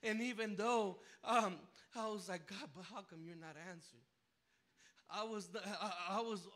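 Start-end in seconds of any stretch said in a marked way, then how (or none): 2.5-4.0 quiet prayer. Retold or imitated.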